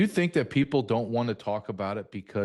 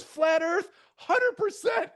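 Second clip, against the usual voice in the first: much higher, excited flat earth declaration; eager yes; 4 dB louder, slower than usual. Retold or imitated. Wife answering her husband's friend, excitedly high and certain. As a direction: gush it, thrilled to be asked.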